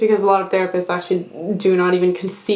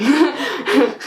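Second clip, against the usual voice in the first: short, higher-pitched burst of laughter; rueful laugh; 7 semitones higher than usual. Real, not acted. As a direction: laugh briefly at your own grim remark. Laughs at her own grim remark.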